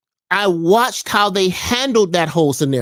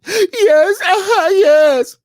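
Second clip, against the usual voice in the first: high tearful hammed-up sobbing; mock sobbing yes; 13 semitones higher, 4 dB louder, much slower than usual. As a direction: wail yes through fake tears. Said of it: "They start crying".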